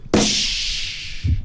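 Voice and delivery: sharp "psh" of shattering glass; explosive mouth-made crash noise; imitated crash. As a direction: mimic a smash with a burst of hiss. Imitates crash he heard outside.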